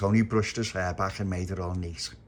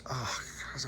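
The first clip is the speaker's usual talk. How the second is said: exasperated whisper; 5 semitones higher, 10 dB quieter than usual. Acted. Tony exasperated at more rules.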